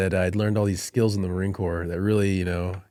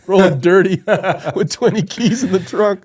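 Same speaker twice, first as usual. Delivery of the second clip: high, loud words through laughter; amused, laughing imitation; laughing retold quote; 10 semitones higher, 8 dB louder than usual. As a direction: quote the kid while cracking up. Laughs while quoting the kid.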